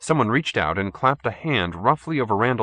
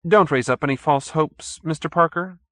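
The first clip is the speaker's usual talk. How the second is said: smiling sweetly; 8 semitones higher, 4 dB louder than usual. A narrator's reading. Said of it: "he said, smiling sweetly".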